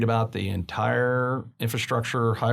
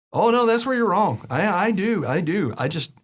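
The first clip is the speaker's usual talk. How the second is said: pitched way up, emphatic denial; animated protest; 7 semitones higher than usual. Retold or imitated. Owner telling his friend he does lose it, animated and high. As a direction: insist with a lively, much higher voice. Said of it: "And I went".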